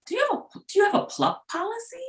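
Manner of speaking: high, lilting question voice of the HR rep; imitating HR rep. On the phone, HR rep asks the wife about insurance, high questioning voice. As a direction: mimic the caller's bright, rising query. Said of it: "Then she asked me".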